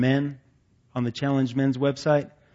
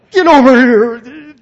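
high, loud drawn-out roar of an enraged father; angry dad yell; 10 semitones higher, 14 dB louder, slower than usual. Retold or imitated. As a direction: bellow like a dad at the end of his rope. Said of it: "I'd get him so hot".

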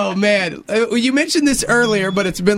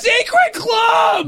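shouted reveal; much slower than usual. Real, not acted. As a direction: shout the name out. Blurts secret loudly.

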